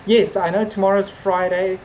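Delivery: usual speaking voice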